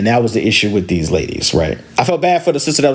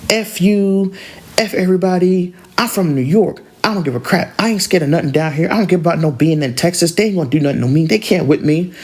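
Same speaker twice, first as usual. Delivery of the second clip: raised-pitch, mock-defiant comeback; defiant retort; 6 semitones higher than usual. Girl rejecting her sister's attempt to calm things, defiant. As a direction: imitate a woman refusing to have it. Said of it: "was like, not having it".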